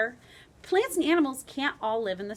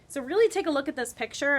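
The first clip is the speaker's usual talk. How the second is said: ordinary talk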